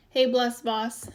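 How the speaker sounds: ordinary talk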